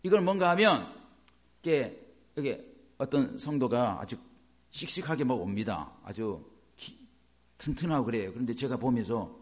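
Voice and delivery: ordinary delivery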